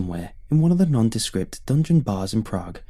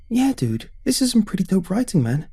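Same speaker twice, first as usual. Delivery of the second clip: put-on high valley girl voice; 10 semitones higher than usual. Thoyon quoted, high voice.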